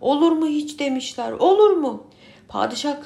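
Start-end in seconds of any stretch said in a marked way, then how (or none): 0.0-0.8 loud protesting shout; crowd shout. Acted. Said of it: "Bağırmışlar, çağırmışlar". 1.3-2.1 higher, louder protesting cry; louder crowd shout. Acted. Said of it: "Bağırmışlar, çağırmışlar".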